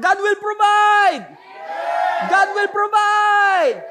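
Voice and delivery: high, loud, slow shout; shouted declaration. Shown as a demonstration. Pastor urging congregation to declare, slow loud shout. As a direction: proclaim it with authority. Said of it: "Lakasan nyo sa chat".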